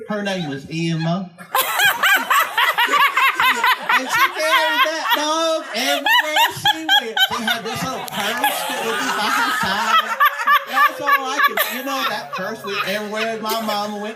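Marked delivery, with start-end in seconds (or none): 1.4-3.9 high, loud cackle; high laugh. Real, not acted. Laughs at dog story. 3.9-11.6 much higher and louder, laughing through words; laughing talk. Real, not acted.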